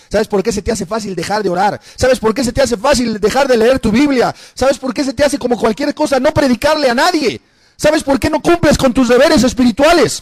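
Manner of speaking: rising, insistent preaching. Real, not acted. (predicación insistente, cada vez más alta)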